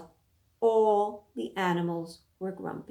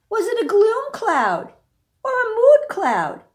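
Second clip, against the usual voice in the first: higher and louder, building questions; rising suspense; 11 semitones higher, 7 dB louder than usual. A narrator's reading. Reader building suspense about the storm cloud. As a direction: pile up the worries, climbing.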